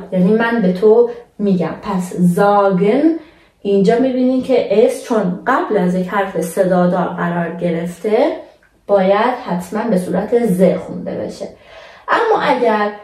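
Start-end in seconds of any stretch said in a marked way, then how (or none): none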